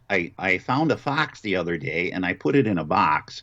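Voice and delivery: fronted Great Lakes O, fox leaning toward "fahx"; Great Lakes accent. Shown as a demonstration. Actor demonstrates regional vowels with a sample sentence, Great Lakes accent. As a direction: put broad Upper Midwest vowels on fox and box. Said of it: "those kind of vowels like that".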